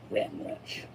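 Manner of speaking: mocking whisper of the name. Real, not acted. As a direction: breathe the name like a secret.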